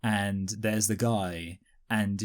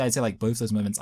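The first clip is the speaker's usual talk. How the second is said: ordinary talk, nothing marked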